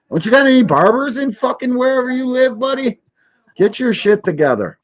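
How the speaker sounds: high, sneering taunt at the screen; mocking high voice. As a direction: roast him in a squeaky mock voice. Mocking the interviewer's barber.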